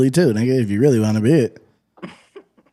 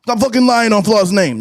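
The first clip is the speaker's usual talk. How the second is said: high, louder mock dog voice; 8 semitones higher, 3 dB louder than usual. Pretend dog scolding his owner, high mock voice. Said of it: "your dog says".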